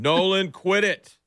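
high, drawn-out scolding; mock yelling dad. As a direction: imitate a dad breaking off to scold his kid. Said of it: "He'd be yelling".

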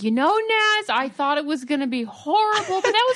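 high-pitched mock dad voice; hammed-up, singsong dad impression; friend's dad. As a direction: imitate a father grudgingly won over. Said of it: "I can just hear your dad".

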